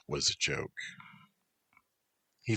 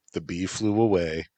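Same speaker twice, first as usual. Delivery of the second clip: plain reading voice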